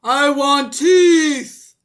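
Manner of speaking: slow, loud, high monster demand; monster yell. A narrator's reading. Tooth monster demanding teeth from Norman, slow, loud and high. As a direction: drag it out like a greedy monster. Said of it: "the tooth monster yelled".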